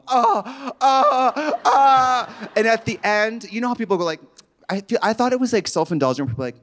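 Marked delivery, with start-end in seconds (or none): 0.1-2.5 high strained groans; mock groans of pain. Groaning on the massage table. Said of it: "on the table going".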